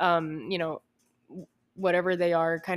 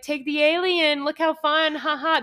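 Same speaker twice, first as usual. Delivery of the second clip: mocking parent voice; 8 semitones higher, 5 dB louder than usual. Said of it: "They're like".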